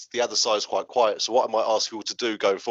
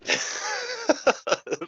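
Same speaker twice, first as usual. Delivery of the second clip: big high-pitched laugh. Real, not acted. Laughing at death joke.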